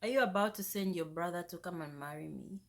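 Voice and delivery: ordinary talk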